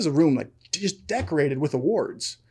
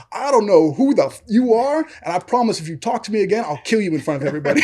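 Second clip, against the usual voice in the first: mock threat; 5 semitones higher, 3 dB louder than usual. Coach threatens the other guy.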